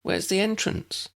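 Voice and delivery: put-on high, puzzled girl's voice; a frowning girl's high question; frowning Lydia. A narrator's reading. Lydia, frowning at the public gardens, asks in a high voice. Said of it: "Lydia looked and frowned".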